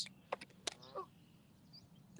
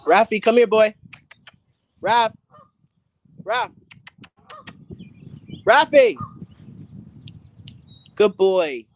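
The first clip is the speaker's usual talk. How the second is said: loud, high call of the dog's name; 6 semitones higher, 6 dB louder, slower than usual. Real, not acted. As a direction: shout his name to bring him. Calling the dog by name.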